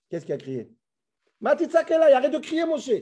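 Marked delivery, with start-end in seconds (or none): 1.4-2.9 high, loud scolding; God's rebuke. Acted. God telling Moshe to stop crying out, scolding. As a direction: rebuke him in a raised, high voice. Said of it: "Kadosh Baruch Hu dit à Moshe".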